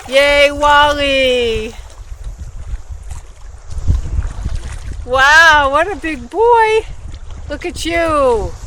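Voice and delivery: high, loud, slow cheer for the dog; excited cheering. Real, not acted. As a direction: cheer the puppy on, high and bright. Cheering dog's first swim.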